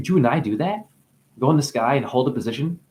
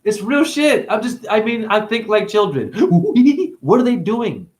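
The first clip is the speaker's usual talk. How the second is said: pitched up through leftover laughter; laughing, high; 7 semitones higher than usual. Real, not acted. Amused at own joke, talking high through laughter. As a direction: still giggling, voice riding high.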